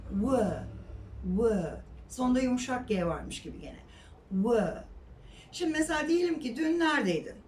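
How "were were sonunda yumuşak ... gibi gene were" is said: "Were." a short weak w sound with no r; correct were. Shown as a demonstration. Teacher modelling "Were." against the Turkish reading. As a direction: say "Were." softly, with a w. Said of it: "were demeyeceksin ona".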